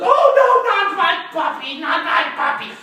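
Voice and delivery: high, loud wail of alarm; Missus Baumgarten. Acted. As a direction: cry out as a distressed dog owner. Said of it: "And Missus Baumgarten said".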